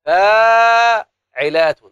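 high, loud, slow "فاعلات" held long; loud sung-out scansion word; loud drawn-out demo. Shown as a demonstration. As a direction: call out "فاعلات" slowly to the class. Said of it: "شايفين التفعيله دي يا اولادي؟".

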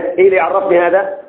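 ordinary talk, nothing marked

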